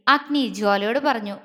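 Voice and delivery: usual speaking voice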